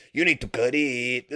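imitated Cheech accent, long stretched ending; comic stoner accent. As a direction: do a playful Cheech accent and drag out the last vowel. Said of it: "if you say it like Cheech, like this".

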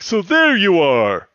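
high, loud, drawn-out cry; big theatrical shout, stretched out; mock booming call. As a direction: call out slowly in a hammed-up cartoon voice. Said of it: "And Scorponok's like".

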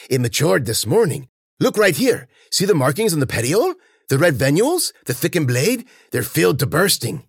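high, eager voice showing off a prize; excited plant keeper. Acted. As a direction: point out each detail with rising excitement.

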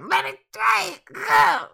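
very high, loud, drawn-out shriek; frantic acted scream. Game master voices him grabbing his injured eye, strained high shriek. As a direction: scream it slowly at high pitch while struggling. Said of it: "and he's like".

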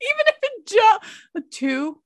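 high, loud through laughter; laughing. Real, not acted. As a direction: squeal the words laughing. Laughing hard.

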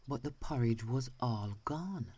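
ordinary delivery, nothing marked

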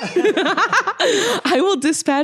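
slow, laughing through "dispatch". Acted. Dispatcher cracking up after saying "dispatch", laughing through the line. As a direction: fight back laughter on the line. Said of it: "What did you call me?".

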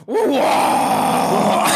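sharp high mock Chinese exclamation; mock dismissive yell. Host imitating Chinese official dismissing American, shouted wah. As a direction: blurt a loud dismissive wah. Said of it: "in Chinese, went".

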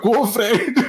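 high cheer through laughter; laughing cheer. Retold or imitated. Fan retelling Fred's goal, laughing cheer. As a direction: cheer him on while cracking up.

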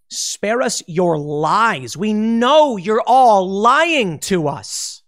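drawn-out, rising loud protest; exasperated retort. Acted. Viewer answering a company's lying statement, loud drawn-out exasperation.

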